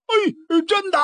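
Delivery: high, gleeful yelp; overjoyed squeal of relief; delighted. Acted. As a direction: jump at the good news. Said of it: "乐得直点跳脚".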